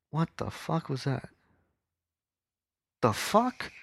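high, stunned disbelief; real startle. Real, not acted. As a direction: break off, genuinely taken aback. Startled by flash, breaks off story.

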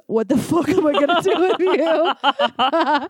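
slow, high and laughing; cry laughing. Retold or imitated. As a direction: laugh it out helplessly. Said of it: "she starts cry laughing".

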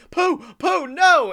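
high, loud anguished cry; Tigger crying out. Retold or imitated. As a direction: Tigger screams for his lost friend. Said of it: "you hear Tigger's voice say".

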